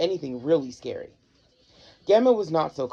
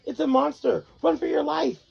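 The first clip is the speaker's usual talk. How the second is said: panicked; 10 semitones higher than usual. Acted. Fleeing a monster.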